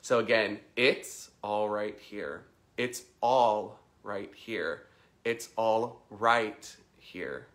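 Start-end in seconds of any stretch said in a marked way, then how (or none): 0.8-2.4 slow mantra leaning on "it's"; "it's" held and set apart; stress on it's. Shown as a demonstration. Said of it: "emphasize the different words". 2.8-4.9 slow mantra with a strong "all"; "all" punched and held; stress on all. Shown as a demonstration. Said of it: "emphasize the different words". 5.2-7.5 slow mantra with a strong "right"; "right" punched, the last word eased; stress on right. Shown as a demonstration. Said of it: "emphasize the different words".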